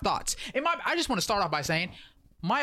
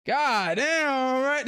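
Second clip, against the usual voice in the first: higher, louder sudden god damn; startled exclamation; 7 semitones higher, 3 dB louder, much slower than usual. Retold or imitated. Boruto turns and sees Mitsuki, startled cry. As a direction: jolt at the sight of an attacker.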